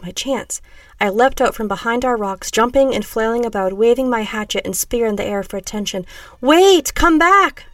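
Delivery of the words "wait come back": high, loud call after someone; urgent shout. A narrator's reading. Narrator to fleeing strangers, desperate shout. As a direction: shout to stop them leaving.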